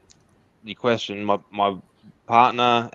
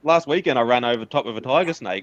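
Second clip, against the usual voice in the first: mock bravado; 6 semitones higher than usual. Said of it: "I'm like".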